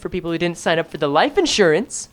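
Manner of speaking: low, measured read; story narration. A narrator's reading. Streamer reading about the regulars, low narration. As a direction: narrate evenly, keeping it down. Reads game narration aloud.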